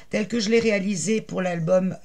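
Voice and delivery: ordinary talk, nothing marked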